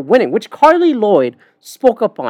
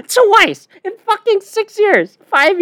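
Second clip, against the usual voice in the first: laughing; 11 semitones higher, slower than usual. Real, not acted. Laughing at Germany's exit.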